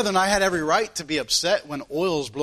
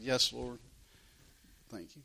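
sheepish mumble, much softer, fading away; mumbled under breath; 8 semitones lower, 14 dB quieter than usual. Retold or imitated. Son mumbling a reply to the Lord, barely audible. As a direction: mutter it back to God like a caught son. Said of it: "mumbling underneath my breath".

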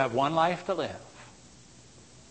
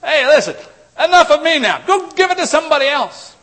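much higher and louder mock protest; imitating an irritated brush-off; 13 semitones higher, 12 dB louder than usual. Preacher imitates a fed-up person waving off witnesses, high and loud. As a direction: snap it off like a fed-up skeptic. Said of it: "I've heard people say that".

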